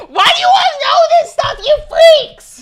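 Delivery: high, loud exasperated yell; mock outraged shout. Real, not acted. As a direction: yell at chat in playful outrage.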